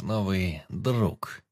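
друг drawn out very slowly and weighted; stress on друг. A narrator's reading. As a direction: lean hard on друг, slowing right down. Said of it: "Он сделал акцент на последнем слове".

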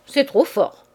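loud, high mock outrage; playful cry of protest; exclaiming. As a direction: cry out, half scandalised, half amused. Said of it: "Elle s'écria".

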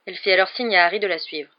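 usual speaking voice

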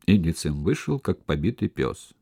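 ordinary delivery, nothing marked

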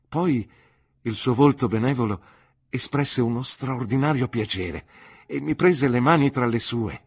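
usual speaking voice

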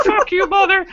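high, loud hammed-up curse; mock yell. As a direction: fling it at the computer. Heroine cursing the ship computer.

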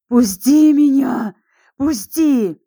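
high, loud, desperate pleading; screaming in agony. Acted. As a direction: scream to be let go. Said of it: "Миклаш зарыдал".

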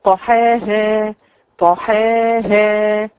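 طحاها drawn out, ha after the alif clear; slow careful recitation. Shown as a demonstration. Teacher recites طحاها slowly for the students. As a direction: stretch طحاها and breathe the ha. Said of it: "تاخدي بالك قوي من الهاء".

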